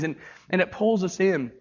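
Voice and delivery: usual speaking voice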